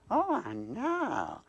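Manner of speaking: slow, swooping Southern drawl; high-pitched, stretched Southern puppet greeting; Southern accent. Acted. Southern dummy greets new acquaintance, slow Southern drawl. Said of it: "that accent".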